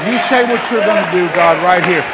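quiet, urgent aside to God; earnest prayer. Real, not acted. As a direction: petition God softly and quickly. Drops into prayer.

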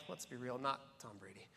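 quiet aside. Real, not acted. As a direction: drop low for a dry joke. Aside mocking commentators.

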